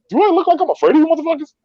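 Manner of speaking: fast, high-pitched mock outrage; acted-out challenge, voice way up; defiant retort. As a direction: rattle off an indignant comeback. Acting out his defiant reply.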